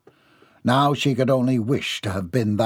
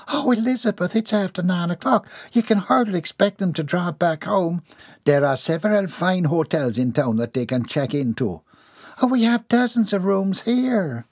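higher, lightly voiced genteel ladies; fussy ladies' exchange; 7 semitones higher than usual. Two hostesses argue over the guests staying, lightly voiced.

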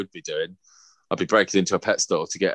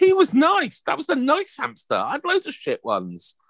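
much higher, whiny pretend sob; mock whiny child. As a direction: play the sad little kid. Mock grief over hamster.

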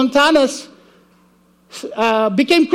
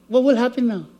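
normal talking voice

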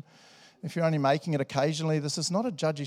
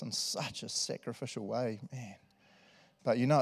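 softer, low spoken prayer with pauses; heartfelt prayer; 3 semitones lower, 3 dB quieter than usual. Real, not acted. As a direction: talk to Jesus simply and sincerely. Leads the church in prayer.

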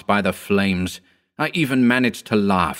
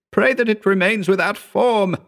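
high, trembling urgent plea; begging in dread; frightened plea; 13 semitones higher, 3 dB louder than usual. A narrator's reading. Howard urging prayer, high and frightened. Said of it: "he shrieked".

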